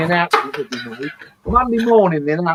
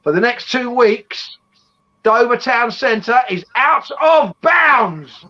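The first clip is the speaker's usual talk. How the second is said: slow, higher, formal parade-ground announcement; commanding officer's announcement; 6 semitones higher, much slower than usual. Retold or imitated. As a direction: drag it out like an officer reading orders to the ranks. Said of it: "commanding officer would then announce".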